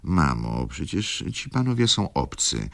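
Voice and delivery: ordinary delivery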